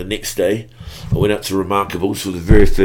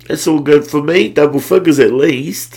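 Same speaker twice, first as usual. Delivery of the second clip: delighted; 7 semitones higher, 7 dB louder than usual. Retold or imitated. Pleased self quote.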